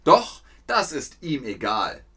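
ordinary talk

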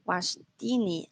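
"Washtini" said slowly, falling; "Washtini" lento y cuidadoso; trying Washtini. Shown as a demonstration. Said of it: "dice Seifi Washtini". ("Washtini" despacio, probando)

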